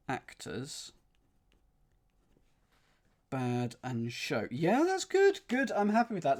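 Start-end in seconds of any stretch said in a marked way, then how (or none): 4.5-6.4 sudden high, loud burst of delight. Real, not acted. Solver cheering a clue he just cracked, excited. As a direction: cheer as the clue finally clicks.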